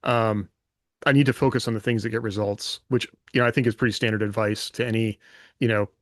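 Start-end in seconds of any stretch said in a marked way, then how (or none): none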